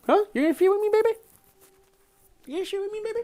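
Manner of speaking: high sing-song voice for the pet; high cooing pet talk. Real, not acted. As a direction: coo up high at the dog. Baby talk to dog.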